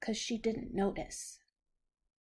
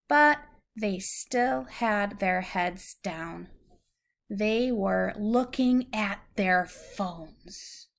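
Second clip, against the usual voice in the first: clear, measured storybook reading; reading to a child; 4 dB louder than usual. Acted. Parent reads a picture book to a child, gentle storybook reading. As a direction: read it out slowly for a little listener.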